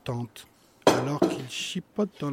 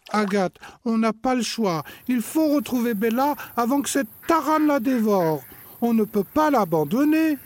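put-on higher, louder determined boy; urgent; 9 semitones higher, 7 dB louder than usual. Brother urging his sister to find the dog, higher and louder. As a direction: push your sister to act now.

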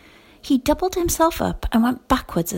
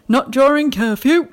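louder, gruff official challenge; stern night watchman; 3 semitones higher, 6 dB louder than usual. A narrator's reading. Night watchman challenging two children out at dawn, stern and loud. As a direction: bark it like a guard on patrol.

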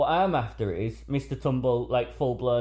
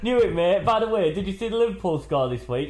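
high, louder laddish voice; imitating a blokey dad; 7 semitones higher, 5 dB louder than usual. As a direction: switch to cheery football-mate banter. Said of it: "the other guy will go".

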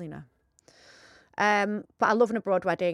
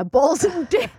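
laughing; 6 semitones higher than usual. Real, not acted. Laughing while reading.

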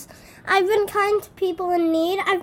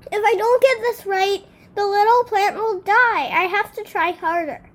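desperate worry; 11 semitones higher, 4 dB louder than usual. A narrator's reading. Dog desperate about dying plant.

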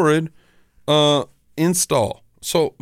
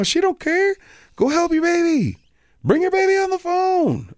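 much higher sugary grandma voice; mock sweet grandma. Mom telling the operator to help her baby, high grandma voice.

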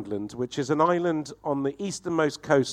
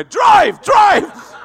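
very high, loud getaway yell; panicked shout; 15 dB louder than usual. Retold or imitated. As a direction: scream at the driver to go. Retold panicked getaway from the lake.

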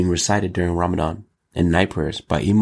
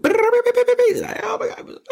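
very high squeal falling away; squeaky gasp; much slower than usual. Retold or imitated. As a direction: mimic a shocked squeaky reaction. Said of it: "everybody's phone goes".

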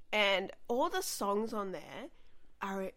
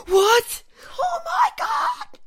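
high, loud overjoyed cry; shocked excited shout; 14 dB louder, much slower than usual. Retold or imitated. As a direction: scream with sudden delight. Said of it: "I was like".

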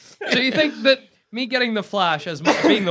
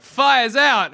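cocky hero shout; 5 semitones higher, 6 dB louder than usual. Retold or imitated. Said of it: "I'd be like".

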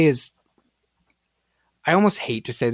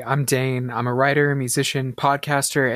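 ordinary talk

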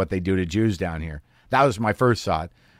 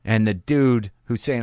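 usual speaking voice

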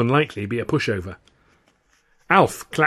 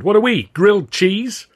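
complaining; 10 semitones higher, 7 dB louder than usual. Acted. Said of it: "another complained".

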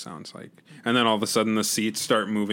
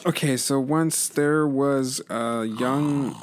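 slow storybook telling; bedtime story voice; 3 semitones higher, much slower than usual. Acted. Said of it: "read me a bedtime story".

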